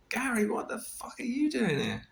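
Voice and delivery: high-pitched, incredulous greeting; retold astonishment at an old friend; astonished. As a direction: exclaim in amazed disbelief, pitch leaping up. Retold shock at friend appearing.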